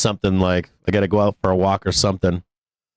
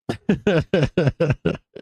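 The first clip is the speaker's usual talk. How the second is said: long run of higher-pitched laughter; sustained giggling laugh; real laugh; 5 semitones higher than usual. Real, not acted. Laughs amid anger at losses.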